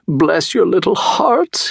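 high, slow, gushing fox voice; crying out. A narrator's reading. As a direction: cry it out in pleading gratitude. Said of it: "he cried".

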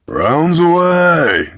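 long, drawn-out high yell; shouted call. A narrator's reading. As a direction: holler it slowly across the deck. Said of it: "Kindle shouted".

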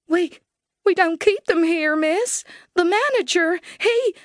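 trembling teller cut off mid-word; terrified teller. Acted. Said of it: "the terrified bank teller".

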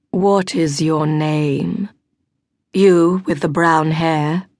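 slow, cold questioning; stern duke asking slowly. A narrator's reading. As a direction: bore into her with each word. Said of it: "cold, harsh features".

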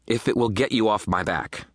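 fed up, voice pushed up; irritated concession. A narrator's reading. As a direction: concede with annoyance. Irritated giving in.

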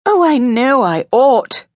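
high, drawn-out cry of regret; distressed cry. A narrator's reading. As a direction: cry it out slowly in anguished self-reproach. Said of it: "she cried".

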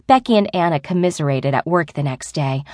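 ordinary delivery, nothing marked

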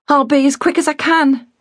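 put-on a girl hurrying off; eager girl. A narrator's reading. Girl's voice.

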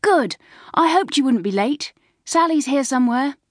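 high, loud schoolgirl chatter; breezy high-pitched thrill; excited. A narrator's reading. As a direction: call out eagerly to a friend just arrived. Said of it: "putting an excited face in".

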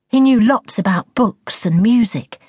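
ordinary delivery

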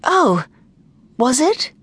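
high, hesitant librarian voice; taken aback. A narrator's reading. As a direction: ask it high, caught off guard. Horrified librarian learns it was a joke.

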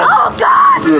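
piercing high scream; terrified scream. Acted. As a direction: shriek in terror. Mother sees possessed son.

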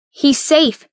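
high, urgent reassurance; urgent insistence. Acted. Narrator holds hands up in front of Evans, urgent insistence. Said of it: "I said again".

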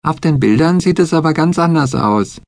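put-on high, insistent girlish voice; high child digging in her heels; insisting Betsy. A narrator's reading. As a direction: insist stubbornly, high-pitched. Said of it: "fragte Betsy".

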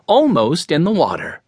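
ordinary delivery, nothing marked

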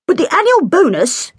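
high pompous trumpeting voice. A narrator's reading. Hugh bellowing at Katy over the music, trumpeting. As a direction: blare it out like a self-important bore. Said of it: "he trumpeted".

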